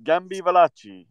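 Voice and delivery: a light mock Italian accent on the name. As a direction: say Gambi Valachi with a hammed-up Italian accent. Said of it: "say that in your Italian accent".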